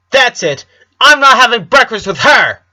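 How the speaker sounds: put-on furious, loud kid outburst; little girl in a rage; high angry child yell. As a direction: shout it and storm off. Angry twin storming off.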